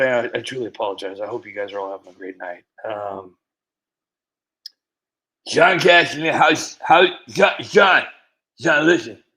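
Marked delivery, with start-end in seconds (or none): 5.5-9.2 louder, higher call to a viewer. Real, not acted. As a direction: grab John's attention. Calling out to a viewer.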